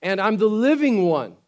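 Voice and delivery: preacher voicing Jesus to the congregation; voicing Jesus proclaiming. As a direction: declare it with full conviction. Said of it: "He says".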